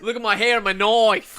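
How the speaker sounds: loud, laughing mock Australian boast; hammed-up Dundee swagger; Trump-Dundee mashup. Co-host mashing up Trump and Crocodile Dundee, laughing.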